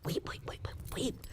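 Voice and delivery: soft unvoiced weep weep weep; whispered sobbing words. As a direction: breathe the words out like soft sobs. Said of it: "just began to weep".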